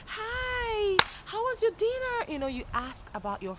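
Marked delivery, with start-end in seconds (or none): 0.1-2.2 very high, sing-out small talk; enthusiastic greeting. Shown as a demonstration. Asking friends how they are, enthusiastic greeting. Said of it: "bring up the words and keep saying".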